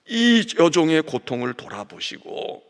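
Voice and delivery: earnest plea. Acted. Hannah's prayer voiced.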